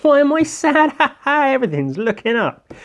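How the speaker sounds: sudden cheerful relief. Pretend. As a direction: burst into silly joy. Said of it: "But then he goes".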